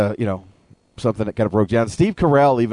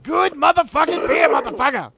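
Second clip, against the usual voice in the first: Samuel L. Jackson voice. Pretend. Chappelle sketch Jackson voice.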